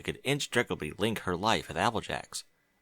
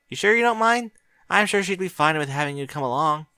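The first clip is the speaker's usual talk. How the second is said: put-on higher, eager young mare voice; Twilight's voice; 7 semitones higher, 4 dB louder than usual. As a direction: ask him kindly and brightly.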